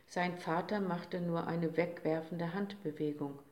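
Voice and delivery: plain reading voice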